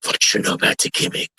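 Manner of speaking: hushed, breathy-free whisper of a question; imitating the Sheik. Wrestler recalls the Sheik's reply to him, whispered. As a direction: whisper it like a shady insider. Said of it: "He goes".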